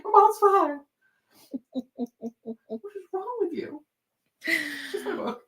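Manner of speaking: high, exasperated reply; mock exasperated. Answering the friend, high and exasperated. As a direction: throw it back incredulously. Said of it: "I was like".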